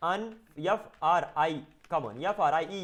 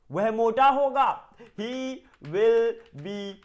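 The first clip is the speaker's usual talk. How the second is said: fat-guy voice; 4 semitones higher, 5 dB louder, much slower than usual. Acted. Acting out a fat person.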